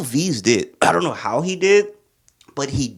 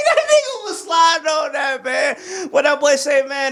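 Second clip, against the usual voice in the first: excited fan shout; hyped shout; 13 semitones higher than usual. Real, not acted. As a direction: yell it like the verse blew you away. Hyped about verse.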